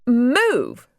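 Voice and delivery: put-on a spoiled girl ordering a pony, high and sharp; high sharp command. A narrator's reading. Regina orders the stubborn mini horse to move, high and sharp.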